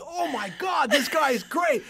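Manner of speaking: put-on thrilled teenage outburst; awestruck excitement. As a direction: relive being blown away. Retells his amazed reaction.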